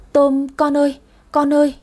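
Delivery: high, anguished calling; stunned stammer. A narrator's reading. Shocked mother to her wounded son, stunned stammer. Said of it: "mồm ú ớ".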